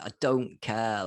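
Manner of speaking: normal talking voice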